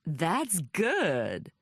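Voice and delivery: delighted American drawl; American visitor, pleased with the slang; American accent. A narrator's reading. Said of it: "Logan Royce had an American accent".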